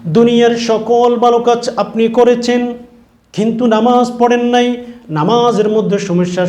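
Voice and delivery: high, loud reproachful sermon delivery; impassioned preaching. Acted. Angels confronting one who skipped prayer, impassioned preaching. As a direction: raise the voice to confront the sinner.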